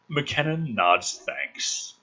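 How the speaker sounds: ordinary talk, nothing marked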